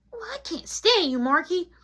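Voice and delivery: high-pitched bratty voice; peeved little brother. Acted. Little brother to Marky at his door, high peevish voice. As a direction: whine it high like an annoyed kid.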